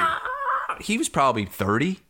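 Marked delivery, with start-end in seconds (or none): 0.0-0.8 high mock yelp of pain; pained cry. Headbutted staffer crying out, high mock yelp. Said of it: "He's like".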